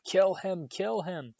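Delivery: high, hard yelled order; yells. A narrator's reading. Voldemort yelling at Quirrell to kill Harry. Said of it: "Voldemort's yells of".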